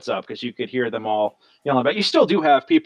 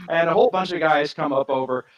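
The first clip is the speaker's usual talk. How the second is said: usual speaking voice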